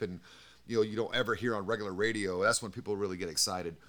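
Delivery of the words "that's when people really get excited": ordinary delivery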